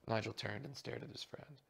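ordinary delivery, nothing marked

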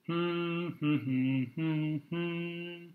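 a playful hum, rising and falling; humming. Shown as a demonstration. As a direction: hum a little tune for the kids. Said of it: "Can you hum like this?".

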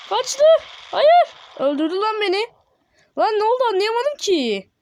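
high-pitched cries of alarm; high, agitated protest; panicked. Real, not acted. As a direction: wail in shock at being killed. Killed in the game.

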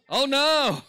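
high, loud drawn-out exclamation; exaggerated echoed cry. Preacher acting his own reaction to the dead car, hammed-up. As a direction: belt it out in comic echo.

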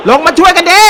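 laughing mock call. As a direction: yell for help down back, cracking up. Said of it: "คาวานี่ตะโกนเรียกเพื่อนละ".

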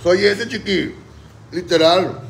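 loud, proud admission. Real, not acted. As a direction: own it out loud. Owning singing badly.

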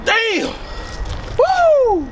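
very high startled shout; shocked yelp. Real, not acted.

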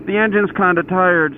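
high-pitched apologetic retelling; mock sheepish apology; bullshit excuse. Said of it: "come up with some bullshit stories".

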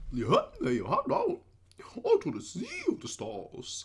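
loud cartoon goof voice, swooping high; hammed-up dopey cartoon voice with leaping pitch; Goofy. Shown as a demonstration. Reactor redoing the song lyric as a joke, goofy impression. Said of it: "That's my best goofy impression".

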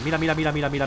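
normal talking voice

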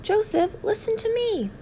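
a mother calling after her son. Acted. Mother calls after running son.

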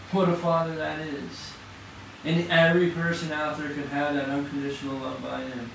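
ordinary talk

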